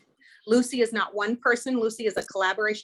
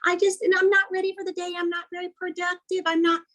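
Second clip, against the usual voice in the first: high, whiny-sounding self-criticism on one held pitch; mock self-critical voice, pitched way up; critical inner voice; 9 semitones higher, faster than usual. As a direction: put on a high voice for nagging self-doubt. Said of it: "that critical voice comes out, that voice that says".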